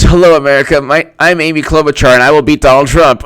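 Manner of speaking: loud, high mock victory speech; hammed-up rally declaration; Amy Klobuchar. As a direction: proclaim it like an overeager candidate. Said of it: "I'm gonna read Amy Klobuchar's victory speech".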